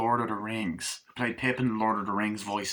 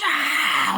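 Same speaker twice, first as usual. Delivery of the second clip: sudden high shriek; mock scream; 6 dB louder than usual. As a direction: shriek to startle him. [name] jumping out.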